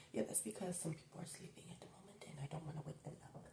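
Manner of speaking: whispering so others can sleep; hushed whisper, trying not to wake anyone; quiet at night. Real, not acted. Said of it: "why my voice isn't as loud right now".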